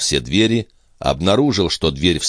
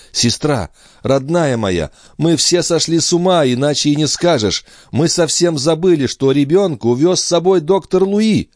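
forced reassurance; 10 semitones higher than usual. Acted. Brother reassures distraught sister.

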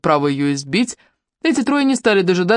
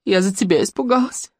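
whisper sobbing; 2 semitones higher than usual. Acted. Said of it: "тихо всхлипывала".

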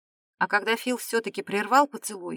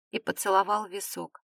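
plain reading voice

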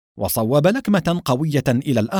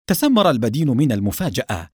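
plain reading voice

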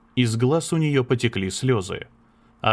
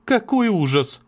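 much higher, louder cry of horror; exclaiming; 11 semitones higher, 4 dB louder than usual. Acted. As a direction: burst out in horror. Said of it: "она воскликнула". (воскликнуть в ужасе)

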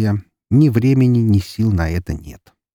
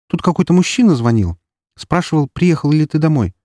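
put-on young sister voice; 8 semitones higher, 4 dB louder than usual.

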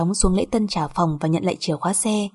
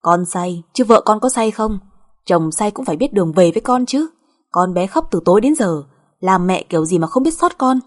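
louder, insistent telling-off; angry scolding; 5 dB louder than usual. Acted. Mother berating son over his crying child, scolding. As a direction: pile up the reproaches.

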